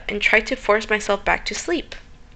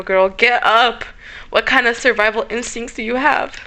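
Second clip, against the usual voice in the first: ordinary delivery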